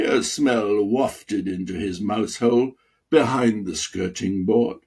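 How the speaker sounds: ordinary delivery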